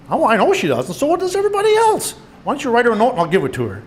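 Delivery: high, knowing lilt; hint of superiority. A narrator's reading. Twin sister answering her brother's crush question, faintly superior. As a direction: toss it out high, as if you already know. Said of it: "a faint inflection of superiority".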